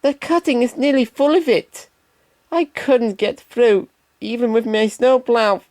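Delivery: anxious little engine admitting defeat; Percy telling Thomas he couldn't get through, high voice; Percy warning. Acted. Percy continues his warning.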